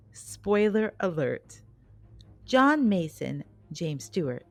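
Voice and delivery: ordinary talk, nothing marked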